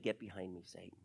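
low, soft, grave rebuke; a low, quiet warning rebuke; hushed stern rebuke. Retold or imitated. Jesus rebuking Peter, voiced low.

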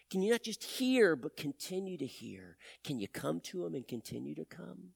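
quiet, intimate sermon ending; soft altar call. Acted. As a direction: gently invite the church to trust. Soft closing appeal.

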